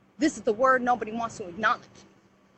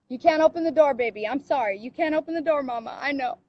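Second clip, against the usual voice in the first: usual speaking voice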